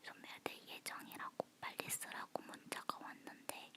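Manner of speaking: ordinary delivery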